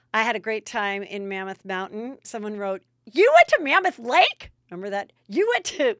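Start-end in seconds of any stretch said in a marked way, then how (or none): 3.0-4.5 high, loud mock-astonished question; mock incredulous question. Mocking an incredulous commenter's message. 5.3-6.0 high, loud mock astonishment, broken off; mock incredulous question. Same commenter voice, cut short.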